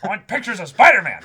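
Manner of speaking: high, loud editor bark; Jonah Jameson yell. Retold or imitated. Editor shouting for his photographer in a loud bark. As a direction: bellow the name like an angry boss. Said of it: "This was Jonah Jameson".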